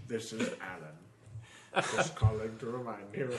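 low, very slow, drawn-out voice; mock Alan Rickman voicemail, low and slow; Alan Rickman impression. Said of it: "Hello, Alan".

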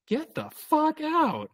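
very high, slow, squeaky mock voice; mock outrage. Reacting to the date's entitlement, high squeaky mock outrage. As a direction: stretch it out in a cartoonish squeal. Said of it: "also was like".

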